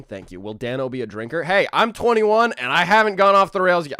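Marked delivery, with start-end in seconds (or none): 1.4-4.0 loud, higher joking brag; half-hearted wild-kid bravado; mock boast. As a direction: proclaim it as a cheeky joke. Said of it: "I'm kidding".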